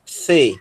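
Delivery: "C" said as "thê", tongue between the teeth; lisped-sounding "thê" for "C"; English TH. Shown as a demonstration. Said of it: "pronunciar como o inglês".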